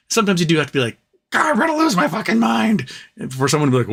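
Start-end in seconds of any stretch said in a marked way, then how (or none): 1.3-2.9 high, strained mock meltdown; hammed-up exasperated rant; mock outburst. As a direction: act like you're totally losing it. Acted outburst of losing it.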